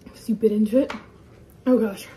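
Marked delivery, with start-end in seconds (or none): 1.7-2.1 gagging on sour candy; dry heave through the words; dry heaving. Real, not acted. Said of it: "my mouth won't saliva stop".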